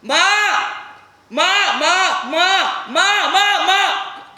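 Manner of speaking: high, full-force screams for mother; frantic repeated shouting; screaming. Acted. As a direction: scream the word over and over. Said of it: "मैं चीखने लगा था".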